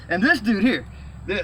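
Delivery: ordinary talk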